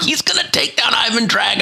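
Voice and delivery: high, hyped movie-fan voice; hammed-up sports-movie fan; mock fan excitement. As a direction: gush about the big fight in a squeaky voice. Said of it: "I'm like".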